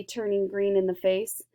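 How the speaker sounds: ordinary delivery, nothing marked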